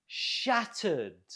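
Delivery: SHAT stressed in Shattered, second syllable falling away; stress on first syllable. Shown as a demonstration. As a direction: model Shattered with the weight up front. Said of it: "stress on the first syllable".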